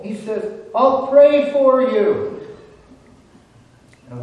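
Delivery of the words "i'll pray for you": high, loud, drawn-out parting call. Acted. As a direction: sing out slowly to someone far below. Priest calls down before leaving.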